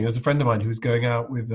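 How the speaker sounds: normal talking voice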